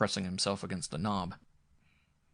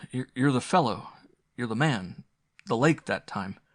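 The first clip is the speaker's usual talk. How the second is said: halting, louder burst of recognition; stammering recognition; 4 semitones higher, 5 dB louder than usual. A narrator's reading. Sturgis realizes the visitor saved him, louder stammer. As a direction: stumble over it as it dawns on you.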